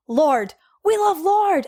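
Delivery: imitated high-pitched burst of enthusiasm; excited fans. Tourists cheering a New Zealand singer, imitated in high excitement. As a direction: play the tourists gushing over a favourite singer. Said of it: "they were just so excited".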